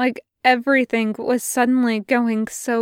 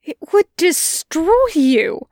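slow, higher and louder in horror; horrified; 6 semitones higher, 5 dB louder, slower than usual. Acted. Angel refusing Crowley's request for holy water, horrified. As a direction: recoil at the very idea.

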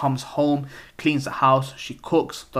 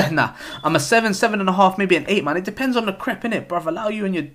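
high, laughing correction of his size; giggly high voice admitting a bigger size; 7 semitones higher, 4 dB louder, faster than usual. Real, not acted. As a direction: laugh it off, voice jumping up. Laughing at own shoe size.

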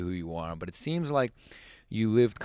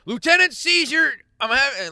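high, loud comic announcement; hammed-up dramatic title; mock announcer voice; 10 dB louder than usual.

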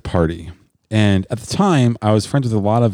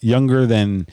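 usual speaking voice

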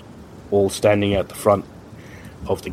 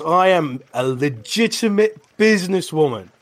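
higher, haughty insistence; big Nord woman brushing off the guards; indignant woman; 8 semitones higher than usual. Acted. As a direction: talk down to them, offended. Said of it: "She goes".

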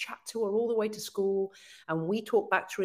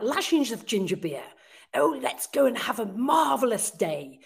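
put-on posh public school girl; 4 semitones higher, 3 dB louder than usual. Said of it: "she's kind of like".

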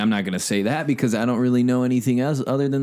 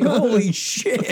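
surprised; 13 semitones higher, much slower than usual. Retold or imitated. Retold own surprised reaction.

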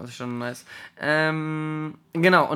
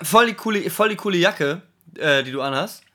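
croaky, throat-clearing, awkward compliment; rusty voice after not talking for long; frog in the throat; 4 semitones higher, 6 dB louder than usual. Pretend. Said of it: "übel den Frosch im Hals".

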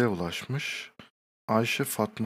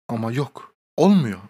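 exasperated exclamation; 2 semitones higher, 10 dB louder than usual. Acted. Exasperated punchline.

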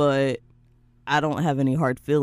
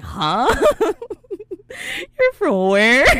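mock confusion; 12 semitones higher, 5 dB louder, slower than usual. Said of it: "they're like".

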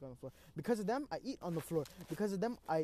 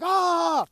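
sudden loud frightened yell; scared scream; 11 semitones higher, 13 dB louder than usual. Retold or imitated. Trapped man screams in fright at a spider.

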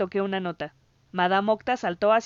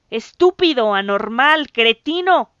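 loud, higher string of insults; screeching; 4 semitones higher, 10 dB louder, slower than usual. A narrator's reading. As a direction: shriek each insult. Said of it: "chilló".

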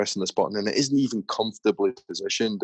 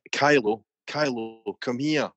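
mild mock shout, pitched up; dad calling out; 4 semitones higher, slower than usual. Dad shouts on his kid, mild retold shout. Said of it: "the dad shouts on the kid".

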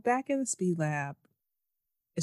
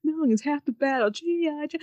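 higher, cheesy cartoon tagline voice; mock PSA announcer; 8 semitones higher than usual. Mocking the show's speech, higher cheesy tagline voice. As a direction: parody an old cartoon moral. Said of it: "It's like".

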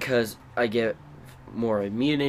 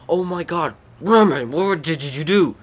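shocked; 5 semitones higher, 6 dB louder than usual. Retold or imitated. Adults shocked at the fight.

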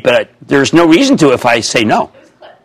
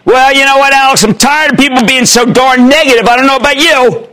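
higher, punchy, fed-up rant; fed-up outburst; 7 semitones higher than usual. Real, not acted. Host venting to caller, higher and fed up. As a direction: vent to the caller about negativity.

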